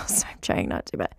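choked-up laughing whisper, quick; fighting back real tears, barely voiced; getting emotional. Real, not acted. Podcast host to her listeners on the year's last episode, choked-up whisper. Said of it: "I'm getting emotional".

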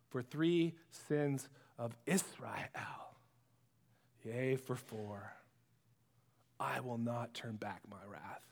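slow, whispered then low doom; hushed ominous oracle. Acted. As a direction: drop to a grave hush. Amos turning on Israel, hushed.

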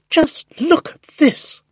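high, loud, drawn-out shout; excited shouting. A narrator's reading. Neighbour shouts up to the balcony, shrill and excited. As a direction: shout it slowly, thrilled, to someone up above. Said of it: "she was shouting".

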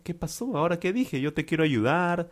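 high-pitched, mildly bewildered protest; confused. Acted. Juan wants to help Lucía and is rebuffed, lightly confused. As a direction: pitch it up, puzzled and pleading innocence. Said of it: "Juan está confundido".

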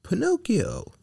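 very high, scolding fairy call; stern. A narrator's reading. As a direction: call the name as a reprimand. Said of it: "said the blue fairy in a stern voice".

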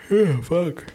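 high yawning groan. Real, not acted. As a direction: stretch it out through a big yawn. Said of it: "I'm yawning like a motherfucker".

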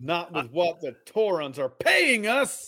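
slow, loud and cold; cold firm voice. Acted. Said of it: "in a cold, hard voice".

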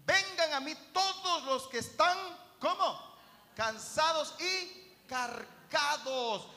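loud, high sermon proclamation; preaching at full force. Acted. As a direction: call it out to the crowd. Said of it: "dijo Jesús".

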